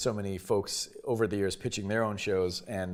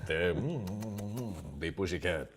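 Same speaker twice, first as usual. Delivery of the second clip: Pepe Le Pew; 3 semitones lower, 6 dB quieter than usual. Shown as a demonstration. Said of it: "I recently voiced Pepe Le Pew".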